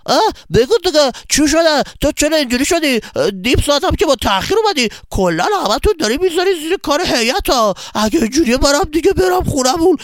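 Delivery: high, loud, whining nagging; complaining boy, exasperated. Acted. Boy scolds his silent late friend, loudly exasperated.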